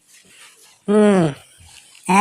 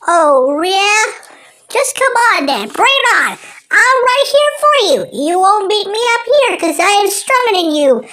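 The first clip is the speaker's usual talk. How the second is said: high-pitched taunting woodpecker; 9 semitones higher than usual. Acted. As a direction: taunt him in a squeaky high cartoon voice.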